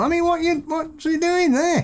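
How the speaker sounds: hammed-up baffled outrage; incredulous protest. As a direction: squeal it in comic indignation. Mock outrage at bones in fish.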